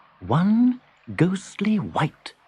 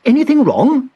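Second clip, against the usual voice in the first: put-on bright high boyish voice; gentle high question to a stranger; Hugo asking; 12 semitones higher, 8 dB louder than usual. Hugo gently asks the huddled shape, high and kind. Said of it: "he asked the dark shape".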